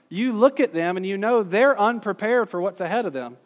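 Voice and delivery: ordinary talk, nothing marked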